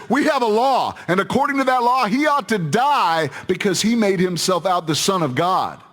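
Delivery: high, pressing, indignant demand; insistent accusation. Acted. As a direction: argue the charge hard at the governor. Accusers insist he must die.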